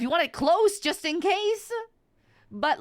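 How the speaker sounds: normal talking voice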